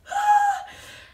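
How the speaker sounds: sharp high gasp; excited gasp. Retold or imitated. Fan hearing the meet is on, gasping. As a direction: gasp in thrilled surprise. Told they can meet the band.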